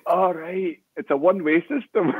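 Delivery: the penny drops for the trapped man; wee voice realising. Retold or imitated. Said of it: "he went".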